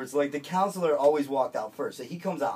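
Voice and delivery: ordinary talk, nothing marked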